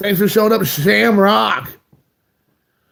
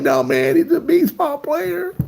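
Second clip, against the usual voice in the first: much higher mock-exasperated voice; exasperated reply; 9 semitones higher than usual. The host explaining Ichiro to baffled coworkers, in a much higher mock-exasperated voice. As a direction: squeak it out, exasperated. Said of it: "I'm like".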